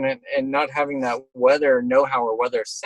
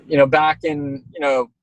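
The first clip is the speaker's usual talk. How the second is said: ordinary delivery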